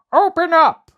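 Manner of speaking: very high, loud barked demand; dog shouting. Acted. Dog shouts at the demons' gate, loud yell. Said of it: "the dog shouted".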